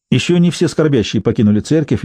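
plain reading voice

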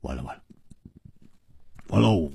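low, very soft despair; spent, dejected and sinking; deflated sigh. Acted. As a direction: mumble it out as if all hope is gone. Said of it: "长长地吐了一口气， 摇着头说".

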